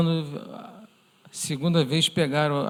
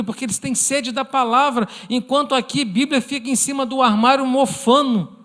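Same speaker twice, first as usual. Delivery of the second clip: higher, louder fiery sermon delivery; fervent preaching; 7 semitones higher, 4 dB louder than usual. Acted. Pastor rebuking a comfortable congregation with fervent preaching. Preacher rising to full force.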